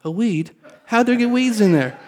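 high, baffled disbelief; surprised. Retold or imitated. Told by wife it's a weed, high astonished. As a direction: rise up in shock at the news. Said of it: "I'm like".

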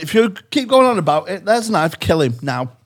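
high, loud fed-up mother; exasperated mum voice. Retold or imitated. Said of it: "So I went".

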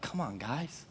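soft, muttered exasperated "come on"; a hushed, fed-up groan at the disciples; exasperated sigh. Retold or imitated. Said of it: "I've been like".

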